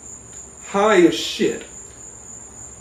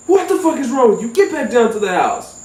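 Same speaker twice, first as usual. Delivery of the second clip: higher, fast exasperated cousin yelling; angry cousin; 6 semitones higher than usual. Retold or imitated. Cousin scolding the stoned narrator, fast and exasperated. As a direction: snap at him to get back home.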